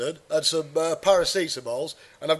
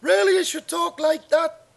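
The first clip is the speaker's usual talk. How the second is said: high-pitched put-on Middlesbrough voice; hammed-up Middlesbrough impression, pitched up; Middlesbrough accent; 12 semitones higher than usual. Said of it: "he's from Middlesbrough, isn't he?".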